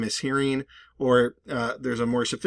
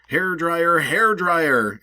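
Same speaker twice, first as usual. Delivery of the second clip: higher, louder summoning call; 6 semitones higher, 6 dB louder, much slower than usual. Shown as a demonstration. Speaker summoning a hair dryer, higher and louder. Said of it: "You're, like, summoning it".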